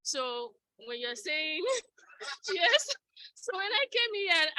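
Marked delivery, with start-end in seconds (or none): 2.1-4.5 high, choked-up voice, nearly crying; tearful, voice breaking. Real, not acted. Thanking her first boss, voice breaking with tears. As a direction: let the tears catch the words.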